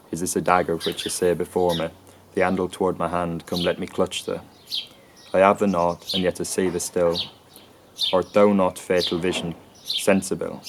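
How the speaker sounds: Macbeth lines with weakened pronouns, run together fast; elided reading. Shown as a demonstration. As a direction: read it like a quick normal talker, swallowing pronouns. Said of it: "apply Crystal's elision patterns".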